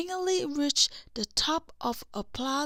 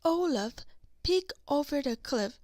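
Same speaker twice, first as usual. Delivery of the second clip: ordinary delivery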